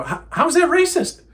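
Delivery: high-pitched incredulous question; incredulous retort. Retold or imitated. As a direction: throw it back, voice leaping up. Retelling his incredulous retort to accusation.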